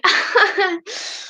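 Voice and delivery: a short embarrassed giggle; laughing at her own line. Real, not acted. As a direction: break into a quick laugh.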